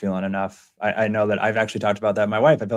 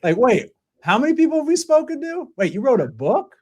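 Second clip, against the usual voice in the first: put-on amazed little kid; 10 semitones higher than usual. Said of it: "if he's like".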